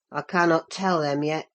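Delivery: low, grudging farmhand reply; sulky Yorkshire boy admits defeat; rough lad answering. Acted. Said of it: "he answered".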